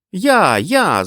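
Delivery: put-on high, loud kid's cry; high-pitched childish protest; child's objection. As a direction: insist loudly like a rival child. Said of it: "заперечив Стефен".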